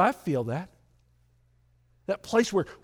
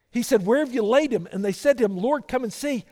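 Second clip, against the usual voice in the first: raised, louder preacher read of the passage; louder preaching; 6 semitones higher, 6 dB louder than usual. Acted. Pastor reading scripture to the church, louder. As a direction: read the verses out with force.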